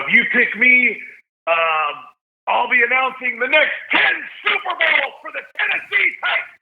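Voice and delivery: cheesy stadium announcer bit; hammed-up announcer. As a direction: boom it like a stadium PA announcer, tongue in cheek. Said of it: "try to be funny to stand out".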